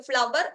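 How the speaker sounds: ഫ്ലവർ with a sounded v, as "flav-ver"; mispronounced flower. Shown as a demonstration. Teacher repeating the form to avoid, mispronounced. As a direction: say ഫ്ലവർ in two syllables with a v. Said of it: "എന്നല്ല pronounce ചെയ്യേണ്ടത്".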